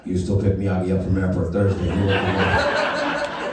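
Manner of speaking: put-on Japanese accent; Mister Miyagi. Sensei tells Daniel's mom about the airport pickup, in a Japanese accent. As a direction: deliver broken English in a caricatured Japanese accent. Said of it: "I'm gonna do an offensive Japanese accent".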